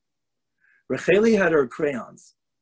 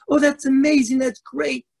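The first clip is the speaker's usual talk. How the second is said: higher, louder gushing mom; delighted mother; 11 semitones higher, 4 dB louder than usual. Acted. Mother delighted by her daughter's plan, high and gushing. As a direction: gush like a proud mother.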